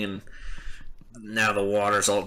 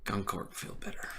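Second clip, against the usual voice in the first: hushed, much softer voice; acted subdued mumble; 13 dB quieter than usual. Half-orc after his rage subsides, hushed and spent. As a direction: let it sink almost to a whisper, rage spent. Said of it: "He's just like".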